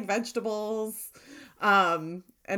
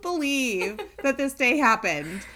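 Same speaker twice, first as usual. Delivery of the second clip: high, slow, loud retold lament; drunken disbelief; 6 semitones higher, 5 dB louder than usual. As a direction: draw out the mock disbelief. Retelling her drunken wailing night.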